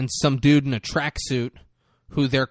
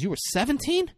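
high, rising, incredulous questions; the dad caught off guard by the age; squeaky outraged double-take; shocked disbelief; 10 semitones higher, 4 dB louder than usual. Retold or imitated. Dad learns mom's age at meeting.

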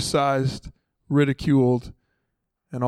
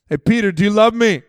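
louder repeat; 6 semitones higher, 7 dB louder than usual. Acted. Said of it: "They weren't listening".

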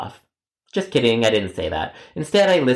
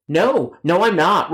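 pitched up a little, firm refusal; a mildly higher no; voice goes high; 6 semitones higher, slower than usual. Retold or imitated. Agent refusing the customer's demand, voice going up. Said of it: "why did your voice go so high".

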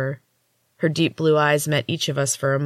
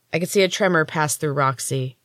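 ordinary delivery

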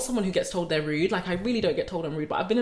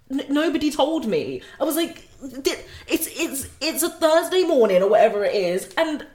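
high, indignant, half-laughing; outraged disbelief; 7 semitones higher, 6 dB louder than usual. Retold or imitated. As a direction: act baffled that nobody warned you. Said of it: "the biggest thought that went through my head is like".

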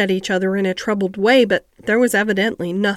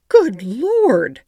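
a sharp startled cry in a housekeeper's voice; startled exclamation; 2 semitones higher, 4 dB louder than usual. A narrator's reading. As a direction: gasp it out in shock. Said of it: "exclaimed poor Missus Medlock".

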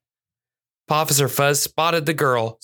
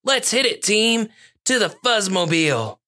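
high-pitched heroic rallying cry; rally the team, pitch leaping up; rallying call; 8 semitones higher than usual. Acted. Cat officer sending his team to the Fuzzmobile, high rallying call.

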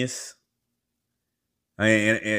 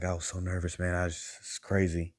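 softer, shaken friend's voice; nervous friend; 8 dB quieter than usual. Retold or imitated. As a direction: hand it back hushed, still rattled. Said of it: "he was like".

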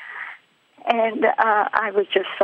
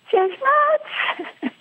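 sudden high squeaky scoff, half laughing; mocking her friend in her head, incredulous high squeak. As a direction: squeak it out in disbelief. Mocking thought about Esther.

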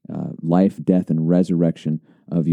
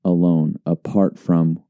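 slow emphatic; 2 semitones lower, much slower than usual. Real, not acted. Emphatic summary of doctrine.

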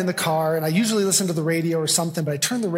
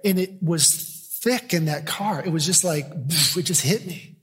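ordinary talk

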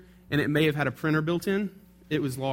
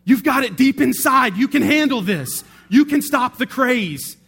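high, loud rousing exhortation; mock inspirational pep talk; 8 semitones higher, 9 dB louder than usual. Lady rallying the clueless clerk, high and loud. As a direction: pump him up like a coach, high and loud. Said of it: "she keeps going".

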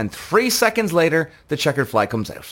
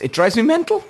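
worked up; 8 semitones higher than usual. Real, not acted. Vlogger ranting about team tactics, worked-up exasperation. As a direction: vent the frustration. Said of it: "I'm getting very worked up here".

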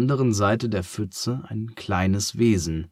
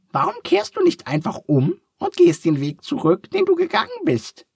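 very high, squeaky little duck, curious; a cheerful duck asking kindly; 6 dB louder than usual. A narrator's reading. Duck asks.

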